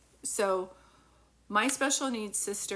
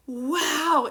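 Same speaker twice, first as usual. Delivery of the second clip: high, loud, drawn-out amazement; big awestruck exclamation, imitated; imitating childlike awe; 7 semitones higher, 9 dB louder than usual. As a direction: act out a wide-eyed child marvelling. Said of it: "she was just in awe".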